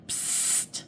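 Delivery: unvoiced soft psst; whispered psst. A narrator's reading. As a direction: hiss a secret call from hiding. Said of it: "said a voice".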